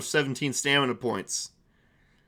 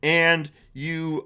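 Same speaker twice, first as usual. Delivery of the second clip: ordinary delivery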